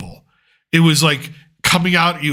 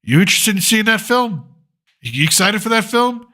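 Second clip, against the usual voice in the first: higher, eager question to family; higher, keen query; hopeful asking; 7 semitones higher than usual. Retold or imitated. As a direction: ask wife and son with eager hope. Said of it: "I asked, I said".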